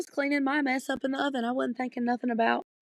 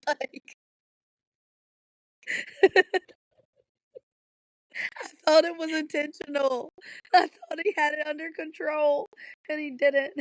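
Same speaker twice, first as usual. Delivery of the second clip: higher voice, on the edge of laughing; helpless laughter; 4 semitones higher than usual. Real, not acted. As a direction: fight the giggles while explaining yourself. Helpless laughing while retelling.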